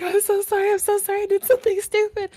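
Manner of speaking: very high, loud frantic apology; hammed-up panicked pleading to mom; freaking out. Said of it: "called my mom freaking out".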